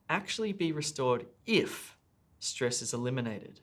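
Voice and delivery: "if" lifted in pitch and followed by a pause; stress on IF. Shown as a demonstration. Teacher shows test takers the emphasised conjunction, stress on "if". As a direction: lift "if", hold, then drop for the rest. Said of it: "I've emphasized if".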